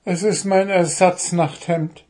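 high, mildly whining gnome; a touch of whining, high; moaning. Acted. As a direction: complain with a mild whine. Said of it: "jammerte Puh".

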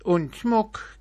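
very high, croaky magpie; greedy magpie croak. A narrator's reading. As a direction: screech like a magpie. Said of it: "krächzte die Elster".

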